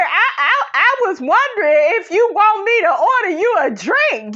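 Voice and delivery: very high, loud squealing voice through laughter; Tisha impression. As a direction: ham up the gushing girlfriend while cracking up.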